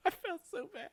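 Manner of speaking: high, giggling through the words; laughing. Real, not acted. Retelling the waitress fall to a co-host, laughing. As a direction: laugh while admitting guilt.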